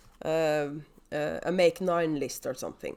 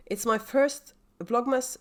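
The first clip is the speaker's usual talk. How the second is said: ordinary delivery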